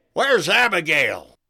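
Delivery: loud, urgent yell. A narrator's reading. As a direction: shout it as you're dragged off. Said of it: "the president yelled as he was whisked away".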